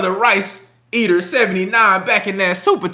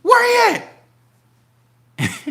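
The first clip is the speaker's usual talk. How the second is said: mock shock rising into a high shouted where he at; excited fake surprise; 12 semitones higher than usual. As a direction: fan pretending he just found out. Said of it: "He was like".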